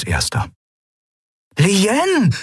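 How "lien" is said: high, drawn-out call of her name. A narrator's reading. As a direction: call out to a long-missed sister, pitched high. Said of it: "rief er". (hoher, freudiger Ruf)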